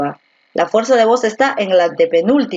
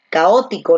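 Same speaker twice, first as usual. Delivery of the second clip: Caótico stressed on the O after CA. Shown as a demonstration. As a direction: say Caótico with weight on its first O. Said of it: "la fuerza de voz está aquí en la O".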